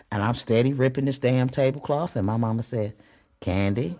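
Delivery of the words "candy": low, quiet warning voice; a hint of a stern mother saying the name; lightly voiced stern mom, low and quiet; mother's warning voice. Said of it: "her voice changed on me".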